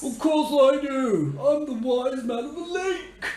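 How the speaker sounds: high, loud proud boast; boastful tree voice. Acted. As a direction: puff up as the wise tree.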